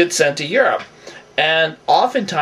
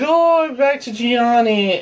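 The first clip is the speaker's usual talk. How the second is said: higher, slower, lightly put-on girly voice; mocking Nicole; 8 semitones higher than usual. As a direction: parody her happy announcement, drawn out. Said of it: "But she's like".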